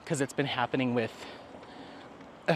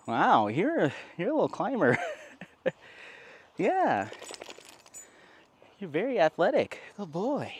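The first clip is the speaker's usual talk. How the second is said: higher, delighted praise for a pet; dog praise voice; 5 semitones higher than usual. Real, not acted. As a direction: cheer the dog on like a proud owner. Praising dog after climbing.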